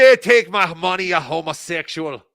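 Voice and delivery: loud opening in a mock Irish-Australian accent; muddled Irish accent. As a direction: start forcefully in a wandering Irish accent. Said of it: "I don't know what accent I'm doing".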